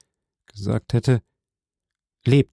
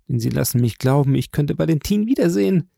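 ordinary delivery

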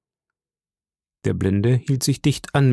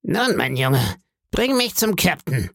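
eerie sing-song beggar; 5 semitones higher than usual. A narrator's reading. Blind beggar begging the innkeeper's boy, high sing-song voice. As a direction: lilt each phrase upward like a pitiful plea.

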